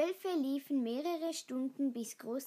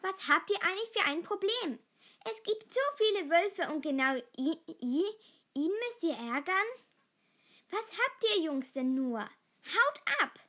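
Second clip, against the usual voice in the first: raised, loud scolding; angry; 6 semitones higher, 6 dB louder than usual. A narrator's reading. Nala confronts the bullies and sends them away, angry. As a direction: tell them off indignantly, building to a shout. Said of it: "Nala lief wütend zu ihnen".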